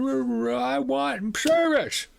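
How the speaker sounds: high, slow, far-off whine; nasty businessman. Retold or imitated. Rude businessman whines at the manager, high and slow. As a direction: demand it like a spoiled child. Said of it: "The nasty Quebecois businessman".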